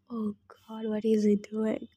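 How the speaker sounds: soft unvoiced whisper; whispered aside. Real, not acted. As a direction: breathe it under your breath. Whispered aside during story.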